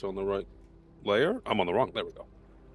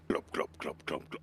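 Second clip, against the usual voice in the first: muttered sound effect; 7 semitones lower, 9 dB quieter than usual. Acted.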